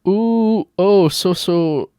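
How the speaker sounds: a hint of a mocking fake Chinese accent, high on "Ooh"; lightly put-on mock accent reading the text. Reading a creep's message aloud, mock Chinese accent. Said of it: "He's doing an accent over text".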